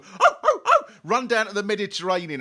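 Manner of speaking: high, loud excited cries as the dog runs off; excited yelp. Acted. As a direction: yelp like you're chasing it down the hill. Mimicking the dog running off.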